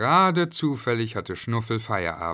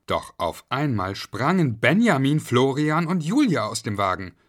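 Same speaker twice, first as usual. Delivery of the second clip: usual speaking voice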